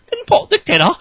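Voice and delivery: high mocking echo. Real, not acted. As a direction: repeat "tin pot dictator" in a high squeak.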